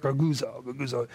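goguzo, goguzo muttered low, fading almost to nothing; a low grumbling murmur dying away; muttered grumbling sound. Shown as a demonstration. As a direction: mutter goguzo like displeased whispers. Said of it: "Doesn't it sound just like that?".

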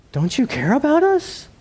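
high, anxious plea; disciples panicking. Retold or imitated. Disciples in the storm pleading with Jesus, high and anxious. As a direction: cry out in worry. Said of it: "they say".

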